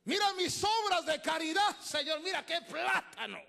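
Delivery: loud, high showing-off prayer; a proud churchgoer shouting about his fruit; boastful believer. Acted. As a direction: brag to God at full voice.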